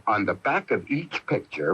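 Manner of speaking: ordinary delivery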